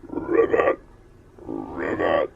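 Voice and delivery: loud croaking ribbit; an old frog calling from the stream. Acted.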